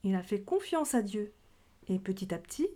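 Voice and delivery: ordinary talk, nothing marked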